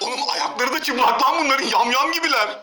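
loud, appalled yelling; shocked shouting. Real, not acted. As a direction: yell out in disgust at the footage. Shocked reaction to the dirty kitchen.

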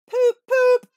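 very high, loud honking horn; car horn poop. A narrator's reading. A sports car shoots past honking a high loud horn. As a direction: honk it like an old motor horn.

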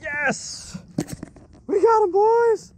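high shouts of triumph over the catch; excited cheer. Real, not acted. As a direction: cheer like you just landed a big one. Landing the fish, cheering.

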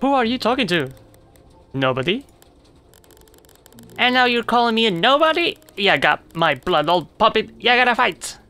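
high, indignant little bug; spider picking a fight. Acted. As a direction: challenge the puppet, bristling. Spider challenging puppet.

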